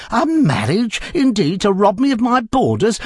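high, loud indignant outburst; outraged. Acted. Landlady outraged at losing her boarders. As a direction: bristle at the very idea.

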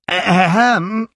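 loud, high throat-clearing ahem. A narrator's reading. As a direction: clear your throat loudly to be heard. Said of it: "called out very loudly".

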